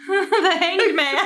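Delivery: laughing in disbelief at the card. Real, not acted. Laughing as she reveals the dreaded card to her friend.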